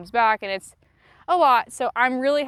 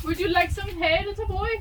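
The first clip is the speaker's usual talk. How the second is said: high sweet offer to a pony; cooing server voice; 9 semitones higher than usual. Pretend. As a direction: coo the question like a waitress. Offering hay to horse in voice.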